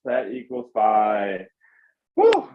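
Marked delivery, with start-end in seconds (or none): 2.2-2.5 excited cheer. Real, not acted. Cheering end of workout.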